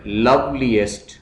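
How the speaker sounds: loveliest in separate beats, love-li-est; split into syllables. Shown as a demonstration. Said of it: "syllables में divide करना है".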